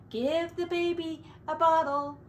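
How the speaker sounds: plain reading voice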